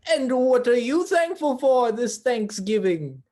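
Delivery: loud put-on turkey voice; hammed-up talking turkey; turkey character voice. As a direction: ask it as a big, loud cartoon bird. Turkey friend asks host, put-on voice.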